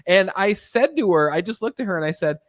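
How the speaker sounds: usual speaking voice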